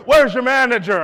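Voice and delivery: loud, high demand; hammed-up angry demand; irate customer. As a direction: imitate an outraged shout.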